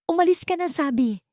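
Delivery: narrated shout, high and urgent; shouted order. A narrator's reading. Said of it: "sa pagsigaw niya".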